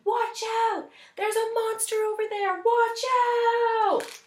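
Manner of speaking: high, loud panicked warning; king shouting warning at his friends by the pond; urgent warning shout. Acted. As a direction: yell to warn them of the monster. King warns friends of monster.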